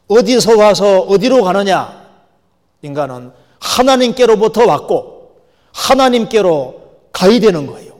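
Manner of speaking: loud, slow, emphatic proclamation; full-force preaching. Real, not acted. Preacher telling people where humans come from, full-force preaching. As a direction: pose it and answer it from the pulpit.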